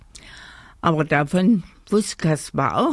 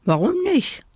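high-pitched, surprised cry; everyone's high-pitched protest; calling out; 13 semitones higher than usual. A narrator's reading. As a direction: call it out high, demanding an answer. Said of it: "riefen alle".

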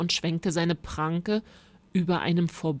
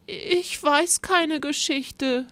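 slow, high whimper; pitiful; 9 semitones higher, slower than usual. A narrator's reading. Rosa with no story for the monster, pitiful. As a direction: whine it out miserably. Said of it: "sagte Rosa kläglich".

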